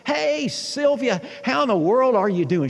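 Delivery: cheery greeting. Retold or imitated. Greeting Sylvia in hallway.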